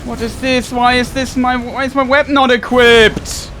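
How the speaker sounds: loud, high, exasperated yelling at the game; furious gamer rant, real anger; angry outburst. Real, not acted. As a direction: blow up at the broken weapon. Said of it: "Sorry for getting so angry here".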